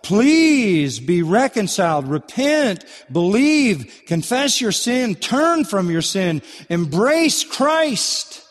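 high, slow, impassioned pleading; begging. Acted. Preacher begging sinners to be reconciled, impassioned plea. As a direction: beg them, stretching each command high. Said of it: "go around begging people".

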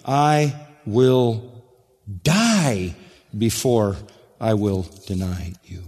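every word set apart with weight, DIE stretched out; emphatic vow. Retold or imitated. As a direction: vow it slowly and proudly, pausing between phrases. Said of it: "he is emphatically proud".

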